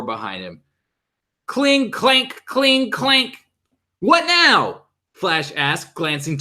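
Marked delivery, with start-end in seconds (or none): 1.5-3.4 high metallic cling clank; clanging sound. Acted. 4.0-4.8 high startled question; alarmed, caught off guard. Acted. Flash alarmed by new threat.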